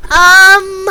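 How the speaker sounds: sudden loud, high burst; high-pitched blast of volume; loud outburst. Real, not acted. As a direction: blurt it out far too loud. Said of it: "I got loud".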